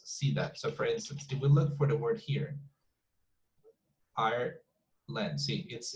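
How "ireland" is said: Ireland slowly, as two separate beats; split into syllables. Shown as a demonstration. Teacher showing a student the two syllables, split apart slowly. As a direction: break "Ireland" into Ire, pause, land. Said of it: "it's two syllables".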